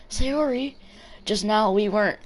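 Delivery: put-on high flustered anime girl. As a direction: stammer it out up high. Voicing flustered game girl.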